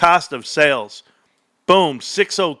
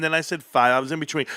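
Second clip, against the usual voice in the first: ordinary delivery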